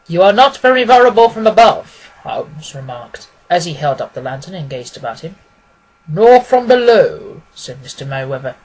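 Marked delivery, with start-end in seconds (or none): 0.1-2.1 louder, higher remark to the cellar; lightly voiced Holmes surveying the vault; Holmes, observant. A narrator's reading. Holmes to the banker in the vault, observant. 6.1-7.4 slow, louder banker's answer; drawn-out, knowing retort; Merryweather, slow and pointed. A narrator's reading. Merryweather to Holmes in the vault, slow and pointed.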